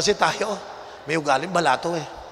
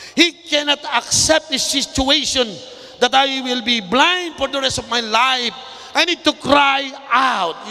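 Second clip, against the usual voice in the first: raised, driving pulpit voice, slowing on key words; fiery preaching; 5 semitones higher, slower than usual. Acted. Pastor urging the congregation to shout to God, fiery preaching. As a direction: preach it hard, calling them to cry out.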